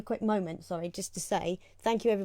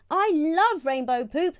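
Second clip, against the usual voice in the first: slow, higher, louder shout; 7 semitones higher, 5 dB louder, slower than usual. Acted. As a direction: cry out in delight. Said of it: "shouted out Mister Knight".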